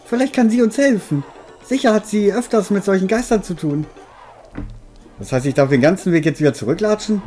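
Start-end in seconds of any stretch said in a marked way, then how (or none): 0.1-3.8 lightly higher character voice; game character voice. Acted. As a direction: read the character's lines. Reads a game character's lines.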